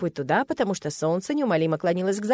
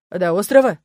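loud, taken-aback question; surprised; 10 dB louder than usual. A narrator's reading. Beaver asked for help reaching island, surprised. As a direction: echo it in surprise. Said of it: "удивился бобр".

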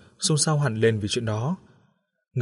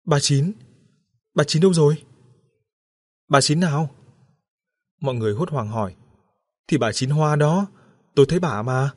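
dazed husband; 4 semitones higher, 4 dB louder than usual. A narrator's reading. Dazed husband wakes, asks.

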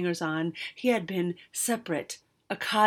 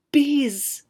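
sudden cry; 6 semitones higher, 7 dB louder than usual. A narrator's reading. Girl finally speaks.

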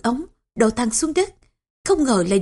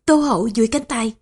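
ordinary delivery

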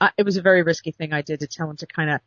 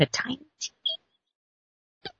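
tiny voice; 8 semitones higher, 11 dB quieter, much slower than usual. Acted. Shrinking voice for tiny.

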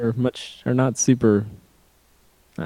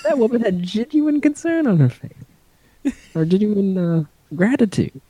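mock awe; 12 semitones higher than usual. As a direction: gush with mock sincerity.

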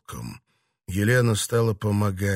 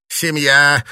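СЕМЬЯ thrown back loud and higher, with scorn; scornful, louder echo of Семья; contemptuous snort; 5 semitones higher, 9 dB louder than usual. A narrator's reading. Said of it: "презрительно фыркнул Эмиль".

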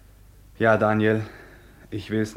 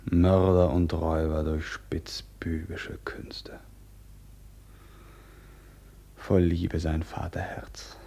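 bitter whisper. Acted. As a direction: brood alone over the betrayal. Karl allein, bitter betrogen. (bitter geflüstert)

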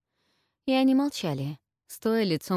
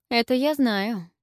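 impatient; 5 semitones higher, 4 dB louder than usual. A narrator's reading. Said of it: "нетерпеливо отозвалась Ольга".

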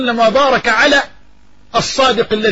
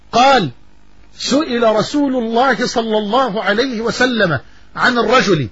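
normal talking voice

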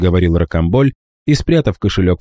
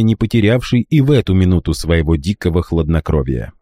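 usual speaking voice

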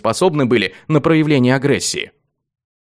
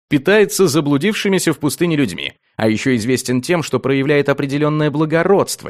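ordinary delivery

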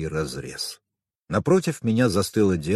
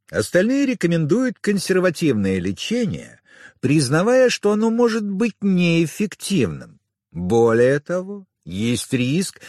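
higher-pitched, measured character voice; high, insistent summing-up; stone-faced official clarifying; 4 semitones higher than usual. Acted. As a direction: lay out the options point by point, raised pitch. Voices Rubinstein in story.

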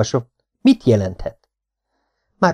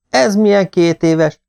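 mock scolding; 9 semitones higher, 3 dB louder, slower than usual. Scolding a naive teammate.

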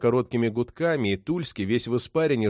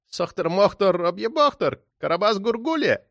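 high-pitched mock incantation; gleeful magic-word patter; showman thief; 8 semitones higher than usual. A narrator's reading. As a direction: chant it like a cheeky conjuror. Thief crows over his trick.

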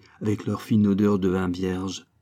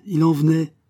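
higher and louder on the repeat; growing wonder; 8 semitones higher, 5 dB louder than usual. A narrator's reading. Narrator marvelling at the arriving thrushes, swelling excitement. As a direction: let the wonder swell as more birds come.

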